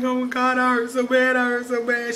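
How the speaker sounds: high whimpering complaint; a touch of a pained cry; mock crying. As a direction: whine like it hurts. Retelling own pain outburst.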